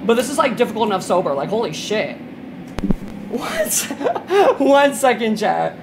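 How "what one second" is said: high, laughing disbelief; real laugh through the words. Real, not acted. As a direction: break into laughter mid-sentence. Laughing at glitchy game.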